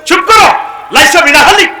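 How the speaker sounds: high, very loud silencing shout; thundering rebuke. Acted. Preacher acting God rebuking Lut's plea, thundering rebuke.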